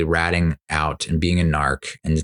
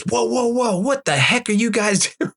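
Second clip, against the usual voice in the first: high-pitched panicky dad imitation; mock an overwrought dad scolding kids, pitched way up; alarmed dad. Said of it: "a dad, he goes".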